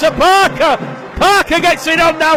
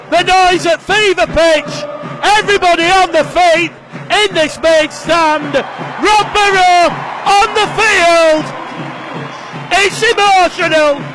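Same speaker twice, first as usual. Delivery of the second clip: emotional call; 2 semitones higher than usual. Real, not acted. Rob Burrow comes on field.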